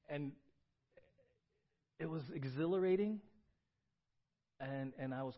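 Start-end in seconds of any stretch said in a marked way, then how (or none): none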